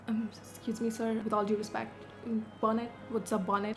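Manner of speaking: polite confusion. Retold or imitated. Confused, polite reply to cop.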